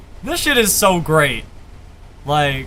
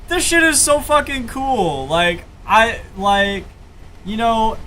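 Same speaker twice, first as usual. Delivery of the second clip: ordinary talk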